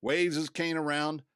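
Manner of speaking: ordinary talk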